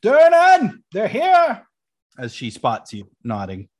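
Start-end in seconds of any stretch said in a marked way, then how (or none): 0.0-1.7 high full shout across a room; yell to the back. Acted. Waitress yelling to the back that guests have arrived, loud shout. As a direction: holler out to someone far off. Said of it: "you see her yell to the back".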